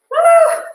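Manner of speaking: high, loud whoop; loud cheer. Real, not acted. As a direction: whoop to lift the class. Cheering after miscount apology.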